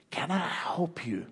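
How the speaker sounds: soft, slow whisper of an offer; whispering the offer to a grieving couple. Retold or imitated. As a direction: lean in and whisper it gently. Tender offer to grieving wife.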